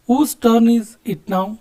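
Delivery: very slow, with each word separated; slow model question. Shown as a demonstration. Teacher showing students how to ask whose turn, slowly. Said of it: "ಯಾರ turn ಅಂತ ಕೇಳ್ತೀವಲ್ಲ".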